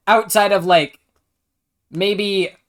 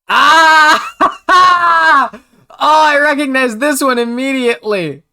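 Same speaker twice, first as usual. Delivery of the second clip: excited shout; 9 semitones higher, 9 dB louder than usual. Real, not acted. Sudden recognition outburst.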